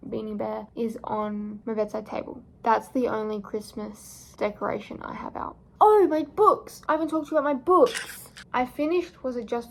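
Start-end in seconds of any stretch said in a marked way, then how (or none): 5.8-9.7 excited. Real, not acted. Suddenly remembers her books.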